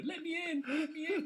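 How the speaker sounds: high-pitched hammed-up Scouse pleading; Liverpool accent. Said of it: "That was my best Liverpool accent".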